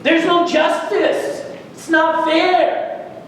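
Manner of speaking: put-on high, indignant complaint as the older brother; resentful. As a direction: protest the unfairness, drawing out the last words. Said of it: "Because he's resentful".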